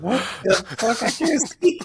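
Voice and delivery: high, loud mock panic in the steam; startled yelling. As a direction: cry out blind and shocked. Blinded in packed steam room.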